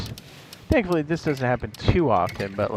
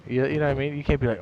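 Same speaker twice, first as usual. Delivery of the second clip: ordinary talk, nothing marked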